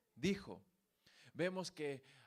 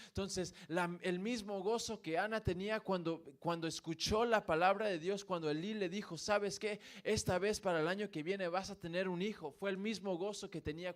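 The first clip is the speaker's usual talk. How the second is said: higher, louder preaching at full force; fervent preaching; 4 semitones higher, 4 dB louder than usual. Acted. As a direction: build the fire of the sermon. Preacher rising again on joy.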